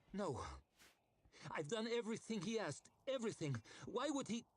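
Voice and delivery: high, frantic protest; panicked lady. A narrator's reading. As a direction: babble in rising panic. Ida panicking.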